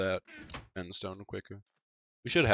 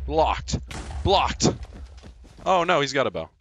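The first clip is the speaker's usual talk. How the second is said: high, loud bursts; excited shout; 8 semitones higher, 10 dB louder than usual. Real, not acted. Gamer reacting aloud in a fight, shouting high. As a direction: yell out each move mid-fight.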